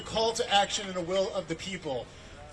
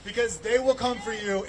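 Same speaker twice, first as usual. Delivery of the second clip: usual speaking voice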